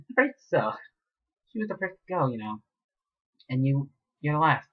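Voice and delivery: high, playful villain voice; enjoy telling her she's last; chatty villain. Acted. Mayor chats to the captive, high and playful. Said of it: "the mayor said, feeling uncharacteristically chatty".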